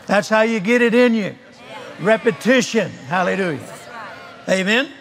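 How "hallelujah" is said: ordinary delivery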